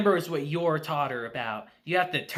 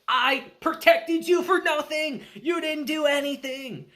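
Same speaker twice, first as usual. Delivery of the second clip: put-on higher, indignant kid's complaint; mock furious; 9 semitones higher than usual. Damien scolding Anya after the loss, mock indignant and higher. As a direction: throw the blame at her, hammed-up. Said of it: "furious about that".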